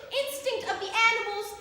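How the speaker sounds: loud, impassioned stage cry; rising declamation. Acted. Universal soul remembers every life, declaimed in rising fervour. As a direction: build to a ringing climax. Monologue builds to a climax.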